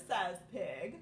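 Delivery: ordinary delivery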